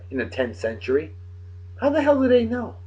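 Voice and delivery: normal talking voice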